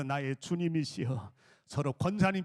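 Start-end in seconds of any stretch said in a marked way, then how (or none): 0.0-1.3 low-pitched, respectful salutation; reverent address. Shown as a demonstration. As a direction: address the elders as lords, low. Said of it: "우리 장로님들 향해서". (낮은 음으로 공손하게 부르기)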